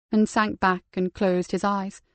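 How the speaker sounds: plain reading voice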